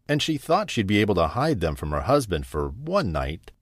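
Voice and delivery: usual speaking voice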